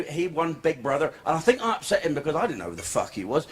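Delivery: ordinary delivery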